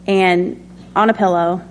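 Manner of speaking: normal talking voice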